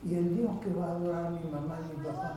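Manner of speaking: a hint of a puzzled little boy; child's question. Child asks why God cannot heal his parents, softly. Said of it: "el niño va a decir".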